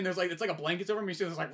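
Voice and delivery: usual speaking voice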